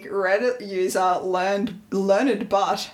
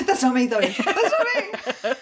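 laughing; 3 semitones higher than usual. Real, not acted. Laughing denial.

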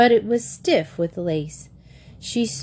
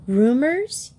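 faltering; 6 semitones higher than usual. Acted. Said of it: "Katy faltered".

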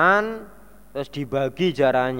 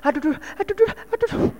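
high, whimpering mock moans of pain; pitched-up pretend groans of pain; imitating a sick moan; much faster than usual. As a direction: act out a sick person groaning aduh. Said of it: "gayae gitu loh".

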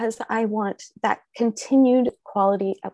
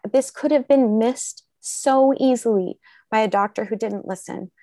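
normal talking voice

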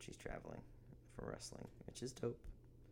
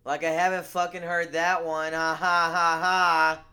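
sarcastic; 8 semitones higher, 16 dB louder than usual. Acted. Sarcastic fake laugh.

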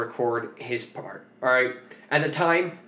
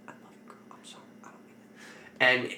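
whispered pleading; 12 dB quieter than usual. Retold or imitated. Drunk rapper backtracking to his girl after screaming, whispered pleading. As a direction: imitate a sudden hushed apology.

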